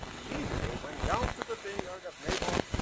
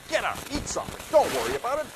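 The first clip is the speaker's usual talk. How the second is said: brisk wife; 9 semitones higher, 5 dB louder, faster than usual. Acted. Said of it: "Jezebel demanded".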